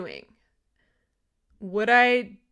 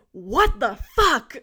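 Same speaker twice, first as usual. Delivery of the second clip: slow, loud, higher shocked cry of disbelief; shocked outburst; 6 semitones higher, 8 dB louder, slower than usual. Retold or imitated. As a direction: blurt it out in sheer panic. Sudden full dilation, panic.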